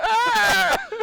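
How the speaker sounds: loud, high mock yell; imitated yell. Showing his slip mid transmission, imitated high yell. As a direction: re-enact a startled yelp. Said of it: "I went".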